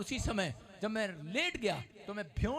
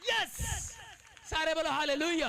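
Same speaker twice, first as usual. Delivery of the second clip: preaching; 9 semitones higher than usual. Acted. Preacher rousing the congregation to praise. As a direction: whip up the congregation.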